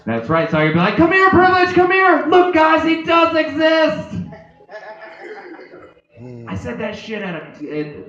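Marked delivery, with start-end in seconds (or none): 1.0-4.2 high, loud call to a pet; excited calling out. Acted. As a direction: call out triumphantly, pitched way up. Calling his cat to show everyone.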